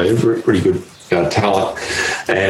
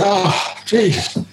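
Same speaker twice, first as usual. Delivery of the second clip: exasperated groan; 13 semitones higher, much slower than usual. Retold or imitated. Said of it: "And I was like".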